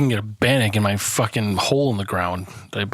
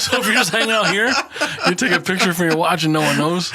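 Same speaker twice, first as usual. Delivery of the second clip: higher, laughing as you talk; can't hold back the giggle; laughing through words; 6 semitones higher than usual. Real, not acted. Laughing while explaining.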